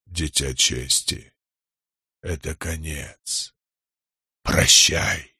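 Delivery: low, slow rumble with long pauses; ominous Stormfather. A narrator's reading. Storm god bids the man farewell, ominous rumble. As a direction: toll out the farewell like ominous thunder. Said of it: "Далёкий грохот сделался зловещим".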